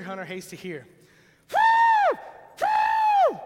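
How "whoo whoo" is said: very high, loud imitated deer blow; deer blow whoo. Preacher imitating a deer snorting whoo at a hunter.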